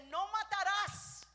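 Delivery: loud, high, slow shout; booming commandment. Acted. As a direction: thunder out the commandment. Preacher quotes the commandment.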